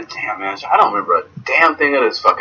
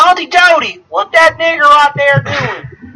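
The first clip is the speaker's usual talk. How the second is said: high, loud caricature of a Southern busybody; high-pitched, exaggerated Southern gawker; mock Southern local; 3 dB louder, slower than usual. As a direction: put on a hollering small-town Southerner. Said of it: "somebody going, talking like".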